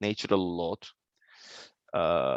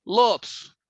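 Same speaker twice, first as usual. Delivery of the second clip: ordinary delivery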